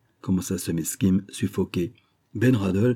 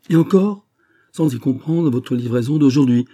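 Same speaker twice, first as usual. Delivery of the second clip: excited exclamation; 5 semitones higher, 5 dB louder than usual. A narrator's reading. Banker enthusing over huge sum.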